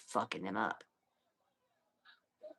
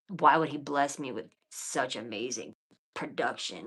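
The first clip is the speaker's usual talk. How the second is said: ordinary talk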